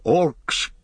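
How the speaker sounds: high one-word alarm cry. A narrator's reading. Gimli warns of the enemy, crying out. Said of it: "cried Gimli".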